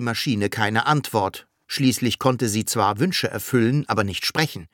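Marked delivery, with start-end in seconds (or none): none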